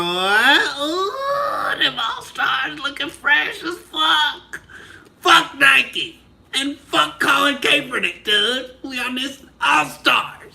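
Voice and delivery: high, slowed shouting in a put-on hype voice; hyped shout. Real, not acted. Host showing off birthday sneakers to chat, hyped shouting. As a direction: crow over the fresh sneakers. Hyping new Converse sneakers.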